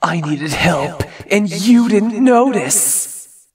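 slow, rising wounded reproach; slow accusing voice. Acted. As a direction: drag out the blame, haunting. Nightmare voice accusing her.

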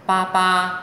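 "pa pa" high and level, then a light second syllable; first-tone "pa" followed by neutral-tone "pa". Shown as a demonstration. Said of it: "thanh một và thanh nhẹ".

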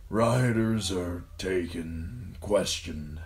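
slow, wispy dying father's voice; thin, pained murmur. A narrator's reading. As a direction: draw it out weakly through pain. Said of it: "thin and wispy and racked by pain".

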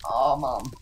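loud, whiny cry for Mum; mock wail for mum. Calls for mum after failing.

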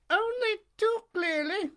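put-on high, squeaky wooden-boy voice; high puppet voice. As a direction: pipe it up like a puppet. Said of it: "answered Pinocchio".

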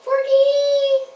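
high, squeaky scared yelp; puppet cry of fear. Pretend. Frightened disciples cry out, high squeaky yelp. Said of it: "they cried out in fear".

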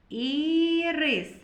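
ірис with a long, high opening і; first і drawn out. Shown as a demonstration. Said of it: "Перший звук «і»".

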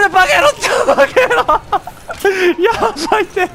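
yelling. Real, not acted. Panicked yelling at enemies.

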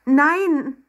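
high, loud, drawn denial; protesting cry. A narrator's reading. Accused Emma denies it to the waiter, protesting cry. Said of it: "rief Emma".

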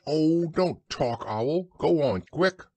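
high, exasperated plea; impatient urging. A narrator's reading. Christopher Robin hurrying the talkative Owl away, impatient. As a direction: cut the chatter and send them off.

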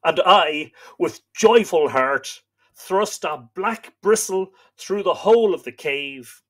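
slow, solemn storytelling read; story narration. A narrator's reading. Host reading myth to live viewers, solemn narration. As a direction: read the old myth aloud, grave and steady. Reads Irish myth aloud.

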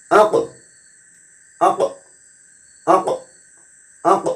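আক with the ক released in a short bounce; bounced qalqalah. Shown as a demonstration. Teacher demonstrating qalqalah to students, bounced ক. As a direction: end আক on a crisp echoing ক. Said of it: "একটু ধাক্কা দিতে হবে".

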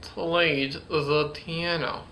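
slow, careful practice sentence; laboured, drawn-out practice delivery; working through it. Shown as a demonstration. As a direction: take each hard word slowly and deliberately. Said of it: "still a rough word for me".

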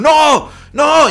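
high, loud imitated cries of refusal; mock-panicked shout; vehement mother. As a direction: blurt it out like an alarmed mum. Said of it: "mi mamá así muy vehemente".

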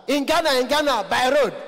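high, loud, insistent repeating; poor man. Retold or imitated. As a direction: shout it high for someone who didn't understand. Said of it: "voice is always very high".

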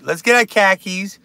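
hammed-up Boston accent, punched out; broad mock Boston accent. Shown as a demonstration. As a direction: do a quick bad Boston accent. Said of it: "I can do a Boston accent".

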